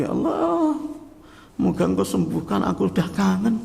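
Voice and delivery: high, wailing plea to be healed; a stroke patient crying to God, high and wailing; tearful plea. Acted. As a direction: cry out longing for the mosque. Voices stroke patient praying.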